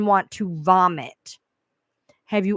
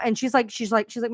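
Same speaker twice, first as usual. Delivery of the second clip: normal talking voice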